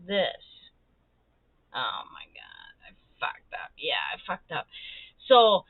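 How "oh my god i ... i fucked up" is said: whispered dismay. Real, not acted. Upset over the wrong canvas, whispered despair. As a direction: breathe it out like a crushed sigh. Realizes the mistake.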